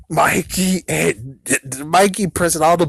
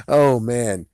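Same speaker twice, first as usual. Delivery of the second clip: ordinary talk, nothing marked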